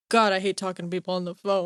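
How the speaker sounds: low, weary grumble after hanging up; dropped real voice. Acted. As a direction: drop the phone act, grumble low. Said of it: "then I hang up".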